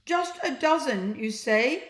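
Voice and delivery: mischievous slime teasing. A narrator's reading. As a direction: ask it with a wink. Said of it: "Slime asked mischievously".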